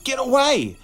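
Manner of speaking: high, loud terrified shriek. A narrator's reading. Boy shrieks at the gargoyle, high and loud. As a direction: scream to drive it off. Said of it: "he shrieked".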